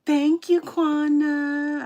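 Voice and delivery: bright, loud, high thank-you; excited thanks. Real, not acted. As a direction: gush over the viewer's comment. Greeting a chat donor excitedly.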